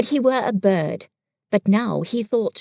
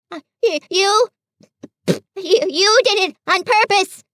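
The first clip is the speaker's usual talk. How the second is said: higher, louder, tripping over words; spluttering; 9 semitones higher, 5 dB louder than usual. A narrator's reading. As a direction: splutter through soap. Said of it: "spluttered Piglet".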